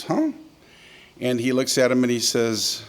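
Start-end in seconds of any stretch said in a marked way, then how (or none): 0.0-0.3 baffled huh. Acted. Farmer baffled by the sheep count.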